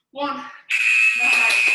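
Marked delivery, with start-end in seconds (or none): 1.2-1.7 loud praise with a low pitch. Real, not acted. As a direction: bark the praise out. Praise after a hard set.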